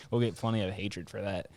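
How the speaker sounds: ordinary delivery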